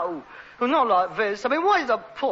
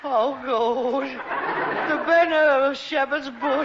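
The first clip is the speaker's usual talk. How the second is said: sneering; 4 semitones higher, much slower than usual. Real, not acted. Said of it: "you can sneer".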